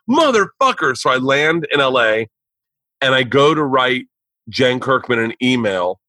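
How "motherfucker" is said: mock outrage. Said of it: "I'm like".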